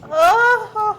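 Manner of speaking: helpless high laughter; laughing too hard to talk. Real, not acted. Breaks up laughing.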